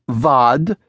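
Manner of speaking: "vod" with a V, slow and teasing; mock Garbo V. Shown as a demonstration. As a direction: tease by swapping W for V. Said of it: "I mean".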